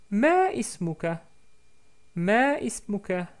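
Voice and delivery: put-on Ma ismuka asked high and slow as the girl; teacher voicing Sarah asking his name, high and slow; girl's voice. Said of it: "répond en disant".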